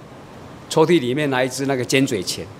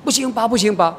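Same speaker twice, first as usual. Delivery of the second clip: retold panicked refusal; 7 semitones higher than usual. Child flees behind mother, refusing.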